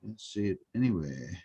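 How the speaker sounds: low, quiet mumble to oneself; muttering to self. Real, not acted. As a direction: think aloud softly while searching. Muttering to himself while searching files.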